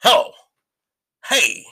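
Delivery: normal talking voice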